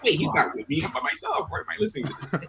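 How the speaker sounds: sudden high startled voice; catching himself mid-song; surprised realization. Retold or imitated. Retelling own thoughts in car.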